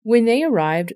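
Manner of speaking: usual speaking voice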